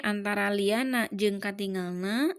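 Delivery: ordinary talk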